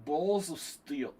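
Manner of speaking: hammed-up Georgian New York accent attempt; [name]. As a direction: try a Russian-New York accent, drawing the words out. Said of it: "I can't even do a regular New York accent".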